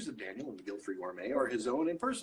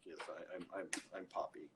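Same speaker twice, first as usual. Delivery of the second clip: much softer, stammering and embarrassed; sheepish admission; 5 semitones lower, 10 dB quieter than usual. Retold or imitated. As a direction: own up quietly in front of everyone. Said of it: "And I'm like".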